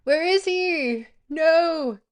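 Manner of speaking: high, loud, drawn-out wail; dismayed cry. Real, not acted. Streamer searching through messages for him, crying out in dismay. Searching for someone, not finding.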